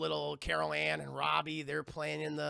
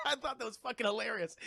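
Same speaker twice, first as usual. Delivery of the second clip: ordinary delivery, nothing marked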